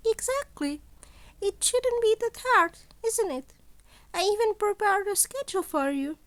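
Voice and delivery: put-on sly, amused old mentor toying with his student; Nezu voice.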